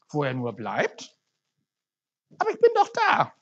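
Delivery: lively storytelling read to the congregation; story read aloud. A narrator's reading. Preacher reading a parable to the church, lively storytelling. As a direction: read the story with animated character voices.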